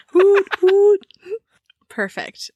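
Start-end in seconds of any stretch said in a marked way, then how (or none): 0.1-1.1 high, loud hoot through laughter; giggly owlish toot; drunk owl hoot. Shown as a demonstration. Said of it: "like a drunk owl".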